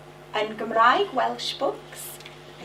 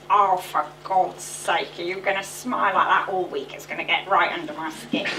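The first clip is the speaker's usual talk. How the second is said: Janet Street Porter; 6 semitones lower than usual. Retold or imitated. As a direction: sneer it out like a fed-up celebrity. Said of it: "Janet Street Porter going".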